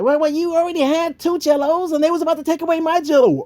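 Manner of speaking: put-on very high, fast whiny kid voice; little brother Russell. Little brother defending himself to Bill, high whine. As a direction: squeak it out like a tattling little brother. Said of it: "And his brother".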